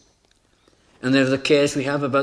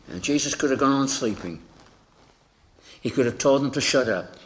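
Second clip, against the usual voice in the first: usual speaking voice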